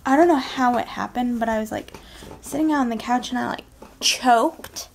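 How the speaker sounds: usual speaking voice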